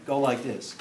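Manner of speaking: ordinary talk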